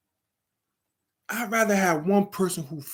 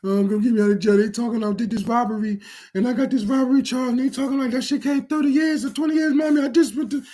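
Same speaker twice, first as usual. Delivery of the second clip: higher, mocking panicked child; mock scared kid; 8 semitones higher, faster than usual. Inmate on jail call to his mother, mocking higher pleading voice. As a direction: ham up a kid begging mom for rescue. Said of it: "You calling your mommy and daddy".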